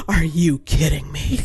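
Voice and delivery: big gasp, then slow amazement; imitating excited kid. Nephew hears about the flatbed, mock over-excited. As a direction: play a thrilled little boy's disbelief. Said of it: "He was so excited".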